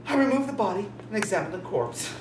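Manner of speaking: ordinary delivery, nothing marked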